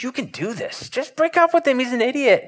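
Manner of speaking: very high, strained put-on voice; mock pep talk. Friend giving unwanted advice on a breakup, mock-inspirational. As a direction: parody a friend's rousing speech way up high. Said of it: "inspire them with this great speech".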